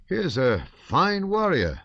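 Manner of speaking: father dismissing his son's plea, teasing; indulgent old father. Acted. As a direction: brush the boy off fondly. Count scolding son mildly.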